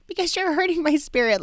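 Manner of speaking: high-pitched mock wounded protest; dramatic complaint. Wife answering her unbothered husband, overdramatic. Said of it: "I was very dramatic".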